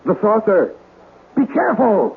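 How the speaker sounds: sudden high alarmed cries; shouting a frightened warning. Acted. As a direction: warn him in sudden panic. Saucer tipping over, alarm.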